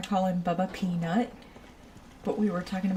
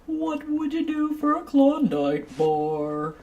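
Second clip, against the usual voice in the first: slow, higher hammed-up ad voice; mock announcer for a Klondike bar ad; ad jingle voice; 6 semitones higher, 5 dB louder, slower than usual. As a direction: pitch it like a cheesy TV ad. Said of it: "Or, um".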